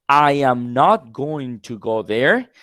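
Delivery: I am not going to go there, slow with not stressed; slow careful full form. Shown as a demonstration. Teacher shows the full form to contrast gonna. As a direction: spell out every word, the textbook way. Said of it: "Instead of saying that".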